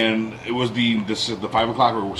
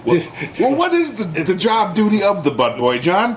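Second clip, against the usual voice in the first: laughing tease; 9 semitones higher than usual. Real, not acted. Teasing John, laughing.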